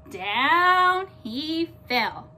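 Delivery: slow and loud falling line; dramatic slow fall. A narrator's reading. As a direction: draw the fall out slowly. Milton falls from roof.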